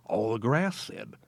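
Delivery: ordinary delivery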